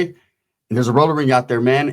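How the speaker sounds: usual speaking voice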